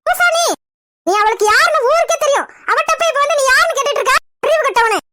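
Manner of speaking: high-pitched exasperated telling-off; angry scolding. Acted. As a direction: scold your foolish son indignantly. Mother scolds her son. (கோபமாகத் திட்டுதல்)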